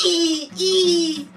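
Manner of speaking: high-pitched double squeak; mock squeal. Storyteller imitates the girl's reaction, high mock squeal. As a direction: squeak it twice like a cartoon.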